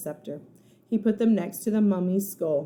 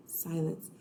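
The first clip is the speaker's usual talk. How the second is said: ordinary delivery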